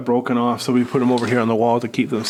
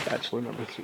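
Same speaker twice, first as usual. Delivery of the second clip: muttered to himself, much softer; quiet aside; 13 dB quieter, faster than usual. Real, not acted. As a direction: repeat it under your breath, much softer. Joke choice repeated quietly.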